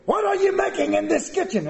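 bad impression of a celebrity chef yelling at cooks; Gordon Ramsay. Preacher mocking a TV chef who yells at contestants, hammed-up. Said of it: "I can't even do his voice".

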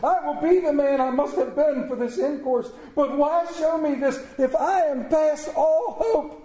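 high, urgent entreaty; impassioned cry for mercy; desperate pleading. A narrator's reading. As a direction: beg as if clutching at a robe. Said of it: "he cried, clutching at his robe".

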